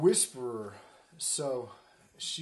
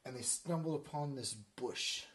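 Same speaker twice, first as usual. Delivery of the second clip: ordinary delivery, nothing marked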